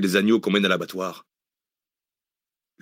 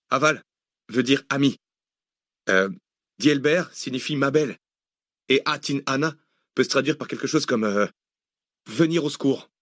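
higher-pitched underling, halting with euh; translating militiaman; 5 semitones higher than usual. A narrator's reading. Militiaman translates Kurdish for his chief, higher and hesitant. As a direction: translate word by word for the boss.

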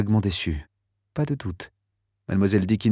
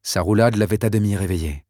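plain reading voice

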